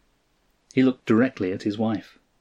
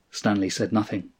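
ordinary delivery, nothing marked

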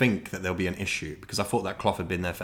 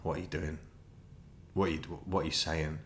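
inner voice; 5 semitones lower, 10 dB quieter, faster than usual. Retold or imitated. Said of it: "in my head, I'm like".

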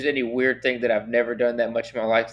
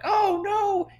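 sudden high-pitched oh no; a squeaky shocked onlooker; mock gasp of horror. Onlookers seeing his missing foot, mock horrified squeal.

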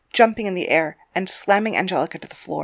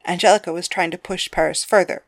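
ordinary delivery, nothing marked

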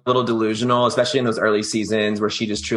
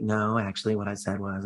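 softer, holding back her temper; mock restrained quiet correction; strained low voice; 3 semitones lower, 8 dB quieter than usual. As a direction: keep the voice down while clearly annoyed. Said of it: "kept this, like, super low voice".

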